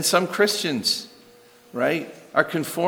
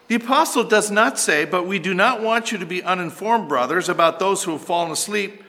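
higher, driving sermon voice with heavy emphasis; impassioned preaching; 8 semitones higher, 3 dB louder than usual. Real, not acted. Preacher urging the congregation that of course they should grieve, impassioned. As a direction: preach it forcefully, punching the key words.

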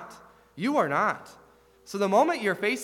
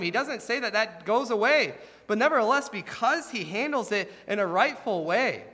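fervent preaching; 6 semitones higher than usual. Acted. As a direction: drive the point home to the congregation. Preaching at rising force.

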